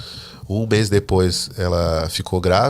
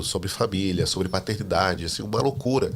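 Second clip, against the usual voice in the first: normal talking voice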